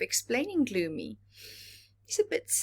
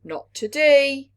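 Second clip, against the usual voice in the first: louder, firm refusal; firm parent; 8 semitones higher, 11 dB louder than usual. Acted. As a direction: shut it down kindly but firmly.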